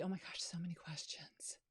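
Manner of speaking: a breathy aside, whispered to herself; overwhelmed, she murmurs it half to herself. Real, not acted. Host overwhelmed by the live chat, whispered aside.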